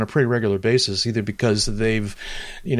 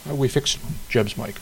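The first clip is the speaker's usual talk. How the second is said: normal talking voice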